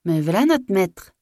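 high, drawn-out call back; answering from attic. A narrator's reading. Maid answering master from her attic, calling out. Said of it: "répondit de son grenier".

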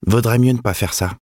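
put-on cold warning in a high boy's voice; froidement. A narrator's reading. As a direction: warn him coolly, pitched well up. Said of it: "dit froidement le garçon". (voix aiguë de garçon, mise en garde froide)

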